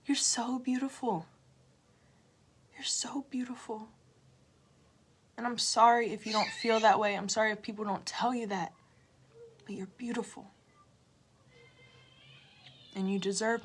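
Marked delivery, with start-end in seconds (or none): 2.7-3.9 hushed, intimate whisper. Real, not acted. Telling viewers they are beautiful, a whisper. Repeated affirmation, now whispered. 9.6-10.5 a soft, unvoiced whisper. Real, not acted. Comforting hurt viewers, a gentle whisper.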